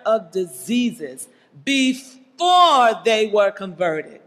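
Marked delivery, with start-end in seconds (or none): 1.6-4.2 "before" drawn out loud; emphatic repetition. Real, not acted. Preacher driving home when the disciples had power, emphatic. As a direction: stretch "before" slowly and punch it. Repeats key phrase for emphasis.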